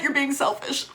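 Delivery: normal talking voice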